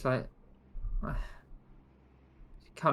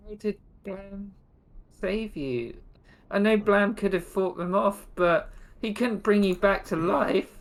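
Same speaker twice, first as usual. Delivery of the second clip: higher-pitched, insistent challenge; disbelieving questioning; 7 semitones higher than usual. Acted. Sandra questions Bungo on being saved, disbelieving. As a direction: press for an explanation, pitched up.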